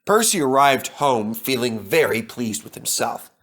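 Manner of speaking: usual speaking voice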